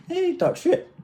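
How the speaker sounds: said through high laughter; high, giggly, laughing through it. Real, not acted. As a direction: laugh the words out. Laughing while explaining.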